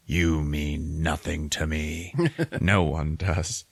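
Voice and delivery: slow, understated mock-Batman line; cold dismissal. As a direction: measure it out, coldly dismissive. Batman coldly dismisses Joker.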